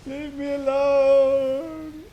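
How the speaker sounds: mock plea. Voicing the fleeing player.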